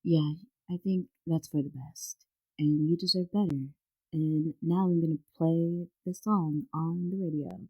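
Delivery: low, consoling late-night radio host; Delilah. Retold or imitated. Radio host comforting a dumped caller, low soothing host voice. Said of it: "And she'd be like".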